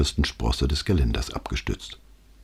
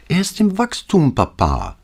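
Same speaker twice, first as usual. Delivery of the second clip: put-on young girl voice; 12 semitones higher than usual. Daughter chiming in at dinner.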